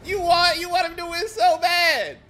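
high, loud mocking laugh at chat; loud gloating taunt. Real, not acted. Streamer taunting chat after beating boss, loud gloating. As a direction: rub the win in their faces.

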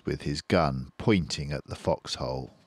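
plain reading voice

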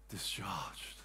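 soft unvoiced discharged; hushed whisper. Soldier released, word hushed.

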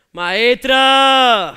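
grita para llamarla, alargando; llámala fuerte desde el público; llamada a gritos. Acted. Espontáneo llama a la cómica. (el espontáneo grita a la maestra desde la butaca)

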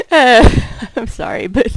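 high, loud nervous giggling through an apology; can't stop a nervous fit of giggles; nervous laugh. Acted. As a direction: laugh helplessly while saying sorry. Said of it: "It's a nervous laugh".

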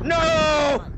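high loud protesting cry; shouting no. Real, not acted. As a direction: yell to stop him. Player about to die.

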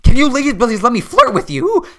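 high, cracking flirty voice; failed flirt. Pretend. Streamer flirting awkwardly with a player, high and squeaky. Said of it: "I can't flirt".

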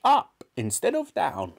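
put-on high, cross old-woman voice; snappish grandmother. A narrator's reading. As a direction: snap the correction in a granny voice. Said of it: "she snapped".

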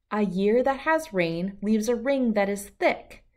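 usual speaking voice

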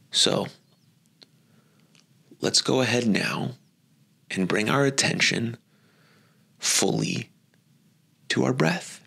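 gently paced, unhurried phrases that follow the breath; guided meditation. Acted. Teacher guiding listeners into attention on their breath, guided meditation.

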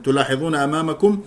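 ordinary delivery

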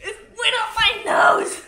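half laughing, half crying. Real, not acted. Hot food up the nose.

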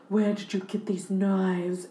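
slow, every consonant overly crisp; lightly voiced Marilyn, over-enunciated; over-enunciating Marilyn. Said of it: "you're, like, over-enunciating".

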